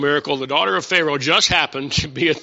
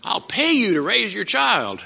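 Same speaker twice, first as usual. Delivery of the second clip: Pharaoh's daughter speaking; 5 semitones higher than usual. Acted. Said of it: "She says".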